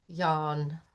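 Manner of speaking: low, soft spoken yawn; sleepy yawn. Acted. Lion yawning while Rabbit panics, low and soft. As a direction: let it out drowsily.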